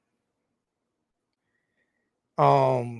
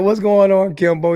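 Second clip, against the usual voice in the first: excited greeting; 6 semitones higher, 5 dB louder than usual. Retold or imitated. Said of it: "I was like".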